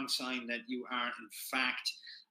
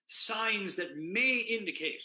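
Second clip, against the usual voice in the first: MAY stretched out and pitched up; stress on MAY; 7 semitones higher, slower than usual. Real, not acted. Host cautioning viewers about ketosis signs, stress on MAY. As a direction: lean slowly on the word "may". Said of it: "underline the word may indicate".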